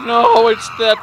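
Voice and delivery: high panicked yelling; terrified horror victim. Real, not acted. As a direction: freak out at the monsters. Panic at screaming creatures.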